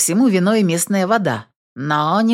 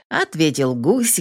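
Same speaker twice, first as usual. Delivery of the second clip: plain reading voice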